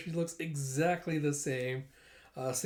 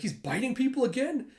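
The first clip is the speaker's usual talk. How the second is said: high-pitched, incredulous question; acted dismay; 9 semitones higher, 3 dB louder than usual. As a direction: ask in startled disbelief. Dismayed at the dog biting.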